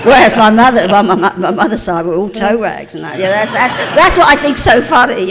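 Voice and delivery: loud, high, laughing delivery. Real, not acted. Joking about mother's side being toe rags, laughing loudly. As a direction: tell it through a big laugh. Laughing at her families' class difference.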